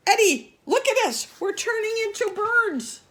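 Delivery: high, loud alarmed shriek; panicked shriek. Acted. Al waking Eddie, shrieking in panic about turning into birds. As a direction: shriek it in horror at the change. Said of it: "Al woke up and shrieked".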